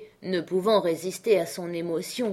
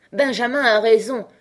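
louder, eager shout of agreement; crowd crying out; 4 semitones higher, 6 dB louder than usual. Acted. The drinkers shout their agreement to Benjamin. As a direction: cheer him on like a rowdy table. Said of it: "s'écrièrent une foule de voix".

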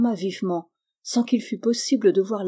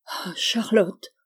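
very slow, voice breaking on the name; broken voice; much slower than usual. A narrator's reading. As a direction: let the voice crack in despair. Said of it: "d'une voix brisée". (voix brisée, lente)

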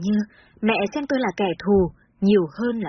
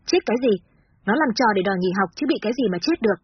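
ordinary delivery

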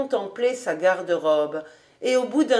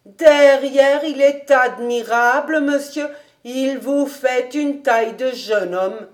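higher, louder delighted housekeeper; gushing admiration; 4 semitones higher, 5 dB louder than usual. Acted. Housekeeper flattering master seen from behind, gushing and higher. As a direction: burst out with admiration, starting loud.

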